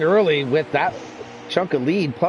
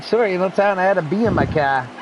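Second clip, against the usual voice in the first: put-on Boston accent; 7 semitones higher than usual. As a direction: imitate a Boston girl through a laugh. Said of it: "she had pure Boston accent".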